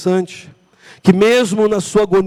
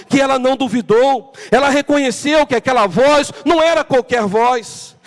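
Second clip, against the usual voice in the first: ordinary talk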